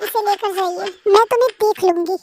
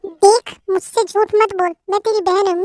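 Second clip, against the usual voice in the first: plain reading voice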